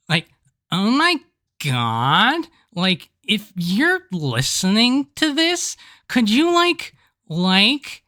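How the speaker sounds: put-on high, drawn-out valley girl voice. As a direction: gush like a bubbly valley girl. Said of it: "somebody needs to do the Valley Girl plug".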